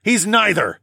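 high, loud rebuttal; roared. A narrator's reading. Parent defending their son, a roar. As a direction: roar it over someone's hysterics. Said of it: "I roared above".

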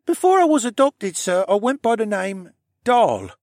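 put-on high, loud, strained child voice; sore-throated boy. A narrator's reading. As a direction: answer respectfully through a sore throat. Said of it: "as he held his sore throat".

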